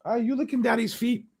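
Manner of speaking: high mocking sneer, way up in pitch; mocking taunt. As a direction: jeer at him in a squeaky mock voice. Mocking jab at Kelce.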